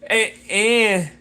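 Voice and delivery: ordinary talk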